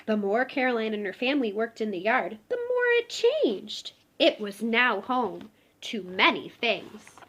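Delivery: gentle storybook read-aloud; reading to children. Acted. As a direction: tell it like a bedtime picture book. Reading picture book aloud.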